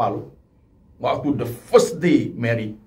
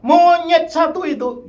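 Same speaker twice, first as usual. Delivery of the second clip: high, loud mock insult hurled at a spouse; a retold jab, shouted high; angry insult; 6 dB louder than usual. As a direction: spit it out like a bitter spouse. Quarreling spouse insults partner.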